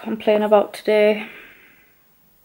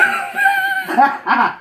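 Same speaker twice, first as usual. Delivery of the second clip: laughing. Real, not acted. Boob joke again.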